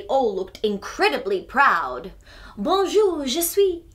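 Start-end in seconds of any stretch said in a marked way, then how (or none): 2.6-3.8 put-on Bonjour, je suis in a French accent; French lines. Classmates reciting Bonjour, je suis in French. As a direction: read out French lines proudly. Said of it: "saying their lines out nice and loud".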